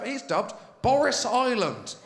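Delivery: ordinary talk, nothing marked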